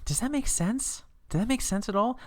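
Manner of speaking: high mock-baffled voice; a touch of mock disbelief; incredulous. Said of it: "But I was like".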